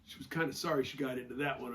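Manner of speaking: ordinary talk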